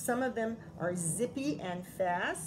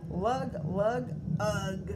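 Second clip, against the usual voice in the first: louder lug lug, then a tired ugh; heavy trudging; 4 dB louder, much slower than usual. A narrator's reading. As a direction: sound like hauling a load. Trudging with huge pumpkin.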